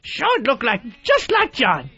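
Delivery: improved [name] impression. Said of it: "that's better".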